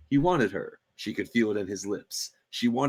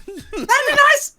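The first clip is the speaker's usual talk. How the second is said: high princess voice; 8 dB louder, much slower than usual. Acted. Penelope's voice.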